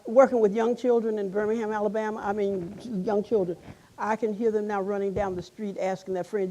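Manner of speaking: ordinary talk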